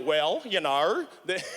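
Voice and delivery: slow, low Australian drawl; Australian zookeeper accent. Acted. Australian zookeeper explaining an animal to comedian, slow mock accent. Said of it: "'cause that's how they sound".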